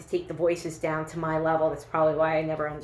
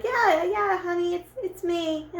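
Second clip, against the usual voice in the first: much higher, squeaky mock sweetness; pretending to be the dog, high and sweet; hammed-up cover-up; 10 semitones higher than usual. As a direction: fake it sweetly to the boyfriend. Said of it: "I'm like".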